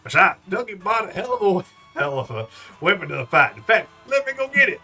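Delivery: proud Southern father showing off; Southern accent. Acted. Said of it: "southern accent".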